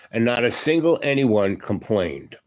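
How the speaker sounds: normal talking voice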